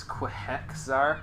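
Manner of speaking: QUEHEXAR as kwih-HEX-ar; mistyped word sounded out. Shown as a demonstration. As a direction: read the typo Quehexar as spelt. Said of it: "proceed to mistype it as".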